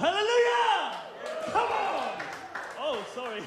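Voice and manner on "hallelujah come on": high, full-force preacher's shout; very loud shout. Real, not acted. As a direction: explode into a rallying hallelujah cry. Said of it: "It's quite loud, wasn't it?".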